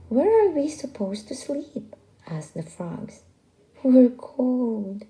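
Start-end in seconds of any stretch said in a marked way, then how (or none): 0.1-2.0 frog asking. Acted. Frogs ask rooster. 3.8-5.0 complaining turtles. Acted. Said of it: "complained the turtles".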